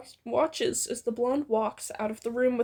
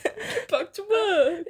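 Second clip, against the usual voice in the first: mock echo; 7 semitones higher, slower than usual. Echoing the mock order.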